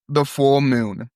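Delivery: slow, drawn-out THE FULL MOON; slow mysterious caller. Acted. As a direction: stretch "The full moon" out evenly. Caller's line, then [name] repeats it.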